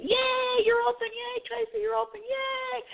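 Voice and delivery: high excited squeals of "Yay"; excited screaming. Retold or imitated. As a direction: squeal with joy at the news. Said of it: "And I started screaming".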